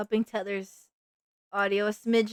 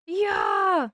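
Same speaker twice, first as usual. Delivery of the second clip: excited shout; 9 semitones higher than usual. Real, not acted. Hyped streamer cheer.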